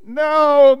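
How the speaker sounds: high, loud bleated refusal; baaing sheep. A narrator's reading. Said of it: "baaed the sheep".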